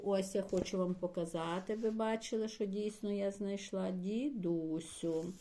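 ordinary talk, nothing marked

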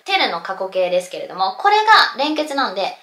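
ordinary talk

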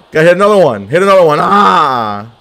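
fired-up gamer hollering; excited shout. Real, not acted. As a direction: shout it on, building to a yell. Calling out a repeated move.

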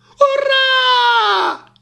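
very high, long shouted hurrah; jubilant cheer. A narrator's reading. Animals celebrate the all-clear, jubilant shout. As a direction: cheer with everything you have. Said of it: "esclamarono gli animali".

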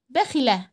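quick kasra in بخلة; short i in بخلة. Shown as a demonstration. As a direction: keep the i of بخلة brief. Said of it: "الكسرة حركة قصيرة".

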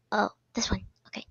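unvoiced whispered aside; whisper to self. Real, not acted. Player whispering while choosing a seat. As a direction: breathe it to yourself.

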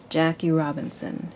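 ordinary delivery, nothing marked

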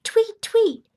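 high, loud chirping; bird tweets. Acted. Reader voices birds arriving for food, high chirps.

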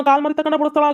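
usual speaking voice

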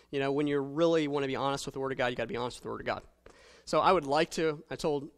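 ordinary delivery

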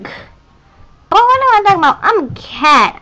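normal talking voice

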